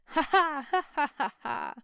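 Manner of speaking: loud, high group laughter; lusty roaring laugh. Acted. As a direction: roar out the laugh together. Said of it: "roared out lustily".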